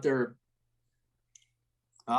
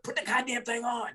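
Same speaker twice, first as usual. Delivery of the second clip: retold yell; 9 semitones higher, 3 dB quieter than usual. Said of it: "I just yelled at him".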